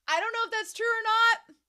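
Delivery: high, loud disclaimer; high-pitched doubt. Real, not acted. Eldest sibling doubting a magazine claim, high and loud.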